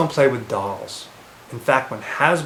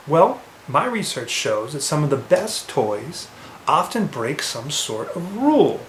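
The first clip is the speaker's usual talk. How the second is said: normal talking voice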